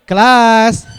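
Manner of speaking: loud call for attention; calling the class. Real, not acted. Teacher calling out loudly to get the class's attention. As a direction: call out to the room.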